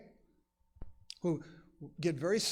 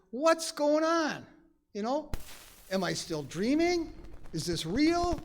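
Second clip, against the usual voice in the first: bewildered waking; 6 semitones higher than usual. Retold or imitated. Voices someone waking up confused.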